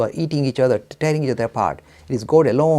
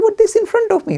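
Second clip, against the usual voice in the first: high, louder, incredulous objection; protesting objector; 7 dB louder than usual. Acted. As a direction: protest as a baffled sceptic. Objector protests against the answer.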